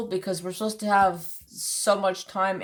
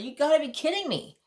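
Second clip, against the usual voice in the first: higher-pitched, exasperated retort; retold pissed-off disbelief; 9 semitones higher than usual. Customer reacting to the canceled pickup, pissed. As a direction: replay an annoyed protest, pitch jumping up. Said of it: "I was pissed".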